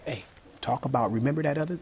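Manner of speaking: soft, sly devil voice egging him on; sneaky devil coaxing someone into an argument, hushed; devil whispering temptation. Acted. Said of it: "And he's like".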